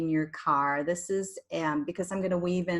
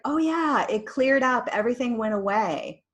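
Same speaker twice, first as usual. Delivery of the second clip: mock relief; 5 semitones higher, 5 dB louder than usual. Voicing a client's relieved report.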